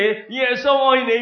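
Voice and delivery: high, loud, drawn-out smiling greeting; smiling delivery. Shown as a demonstration. Preacher showing how to hand out leaflets, smiling delivery. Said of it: "你笑笑地".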